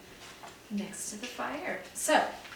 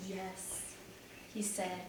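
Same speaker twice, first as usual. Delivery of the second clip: softer, subdued confession; quiet admission; 6 dB quieter, slower than usual. A narrator's reading. Peter admits the thefts to Missus Parsons, softly. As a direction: let the yes drop out quietly.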